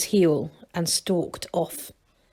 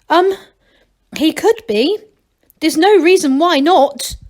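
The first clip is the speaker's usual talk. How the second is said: higher, hesitant gnome voice; unsure and stalling; nervous young gnome; 7 semitones higher, 5 dB louder than usual. A narrator's reading. Masklin answers nervously, voiced higher.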